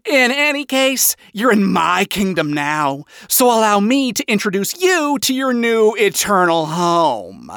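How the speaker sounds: grand, smug welcome starting high. A narrator's reading. King of Hell welcomes Adam to his kingdom, smug. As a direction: play the self-satisfied host, stretching the last word. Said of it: "not once losing the smug tone".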